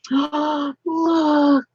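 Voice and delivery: high, gasping amazement; gasp, then a high stunned exclamation; awed surprise. Real, not acted. Peeking into her husband's present, awed surprise.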